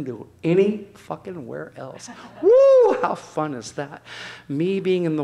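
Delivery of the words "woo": very high, loud laughing woo; delighted whoop. Real, not acted. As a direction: let the joy burst out. Joy of dancing in water.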